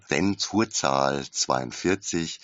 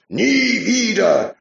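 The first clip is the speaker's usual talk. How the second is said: very high, loud, drawn-out cry; slow, loud, high cry of Nie; screamed; 8 dB louder, much slower than usual. A narrator's reading. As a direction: scream it to the rafters. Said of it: "schrie der Mann".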